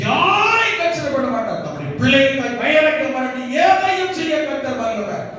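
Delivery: high-pitched, rousing sermon climax; full-force preaching. Real, not acted. Preacher rallying the church that God never forsakes, full-force preaching. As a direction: preach it high, driving each question to its answer.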